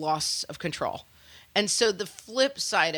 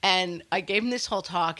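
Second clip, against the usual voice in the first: normal talking voice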